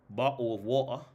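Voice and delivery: put-on British accent, dropped t's. Shown as a demonstration. Vlogger practising a stock phrase, mock British accent. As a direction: imitate a Brit with glottal stops. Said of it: "let me try the British accent".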